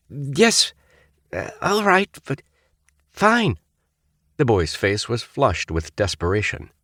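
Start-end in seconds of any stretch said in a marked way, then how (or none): 0.1-3.6 flustered. A narrator's reading. Panicked cadet fumbling.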